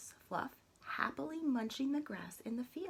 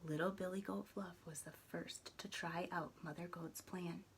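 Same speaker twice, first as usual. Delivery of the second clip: hushed narration; 10 dB quieter than usual. A narrator's reading. Quiet tiptoe scene.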